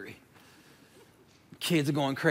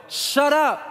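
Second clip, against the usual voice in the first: yelled; 8 semitones higher, 4 dB louder than usual. Retold or imitated. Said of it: "I literally yelled".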